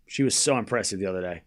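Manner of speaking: ordinary talk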